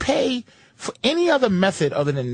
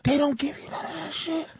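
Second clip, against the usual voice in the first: mock disbelief; 6 semitones higher, 7 dB quieter than usual.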